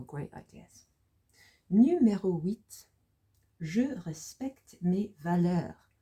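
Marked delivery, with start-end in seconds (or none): 1.7-2.9 slow, higher, louder French announcement; announcing next item. Shown as a demonstration. Teacher moving learners to the next sentence, announcing it. 3.6-5.9 slow French, each word drawn out; slow model reading. Shown as a demonstration. Teacher introducing sentence eight to learners, slow model reading.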